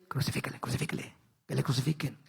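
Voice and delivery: whispered, voiceless call to crucify; hushed crowd murmur. Retold or imitated. Incited crowd murmuring, whispered and voiceless. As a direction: hiss it like a crowd being stirred up. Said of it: "La gente, incitada por los líderes".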